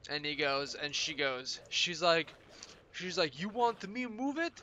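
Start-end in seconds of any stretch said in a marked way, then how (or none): 3.4-4.5 high-pitched challenge in mock Slavic accent; thick accent. Kitchen boss challenges cheeky dishwasher, thick accent. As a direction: throw the dare back in a thick Eastern European accent. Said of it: "thick accent".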